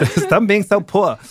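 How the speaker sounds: hammed-up socialite put-down; Anna Delvey accent. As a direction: drawl it like a snooty rich girl. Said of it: "that was your best impression".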